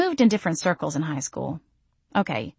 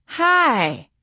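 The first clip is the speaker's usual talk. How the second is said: put-on too loud drunk voice; 8 semitones higher than usual. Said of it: "I yelled in a too loud drunk voice".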